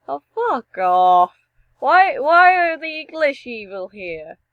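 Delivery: loud, rising indignation; comic outrage at an insult; exasperated protest. Real, not acted. Protests at evil English in game.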